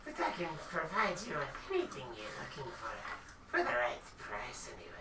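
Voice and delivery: hushed, secretive sales pitch; sly shopkeeper. Acted. Shopkeeper voice, whispered end.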